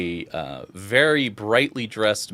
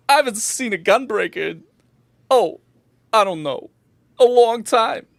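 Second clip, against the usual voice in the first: high, animated barkeep voice; curious bartender; 12 semitones higher, 4 dB louder than usual. Acted. Bartender asking a gunbreaker what brings them here, high and curious. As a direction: chat up a rare customer in a high voice.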